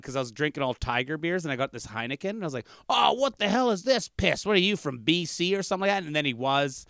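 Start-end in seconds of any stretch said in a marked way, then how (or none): 2.9-6.1 high-pitched, hammed-up indignation; mock disgust. Comic handed a Heineken on stage, mock disgust. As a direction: act outraged, pitched well up. Acted heckle over the wrong beer.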